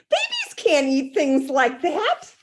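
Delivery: lightly voiced upset mother; scolding mother. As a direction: lecture her, a little high. Missus Lane scolding.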